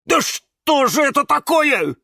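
loud, high exasperated cry; exasperated outburst. A narrator's reading. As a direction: burst out, thoroughly fed up. Cactus jumps up, exasperated.

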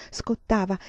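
usual speaking voice

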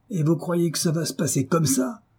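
restrained outrage, rising; contained anger. A narrator's reading. Husband challenges his wife over her gift, contained anger. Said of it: "en tâchant de se contenir".

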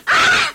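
shrill, high, loud scream of fright; mother grabbed by the thirsty man, screams; startled scream. Acted. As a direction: shriek as he seizes you.

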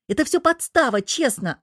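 high, loud start of self-justification; defensive, high-pitched outburst easing off; pleading excuse. A narrator's reading. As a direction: protest your innocence. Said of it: "оправдывался чёрт".

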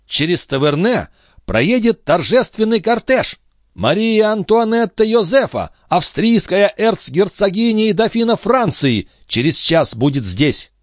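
high-pitched, excited proclamation; ceremonial announcement. A narrator's reading. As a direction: proclaim a royal arrival in a high, lifted voice. Son announces royal procession. (торжественное, высокое объявление)

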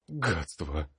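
breathed out on a shaky exhale; exhaled curse. A narrator's reading. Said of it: "выдохнул я".

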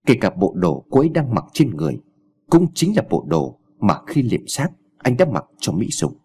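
usual speaking voice

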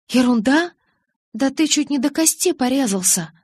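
high incredulous echo, then protest; agitated high-pitched objection; indignant disbelief. A narrator's reading. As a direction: throw the word back, appalled. Indignant retort about the bad cut.

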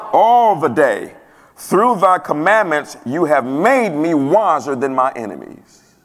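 slow, emphatic preacher's recital, swelling and falling by phrase; scripture reading. Real, not acted. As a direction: proclaim each verse with long drawn-out key words. Reads the psalm aloud to congregation.

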